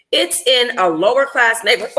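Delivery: usual speaking voice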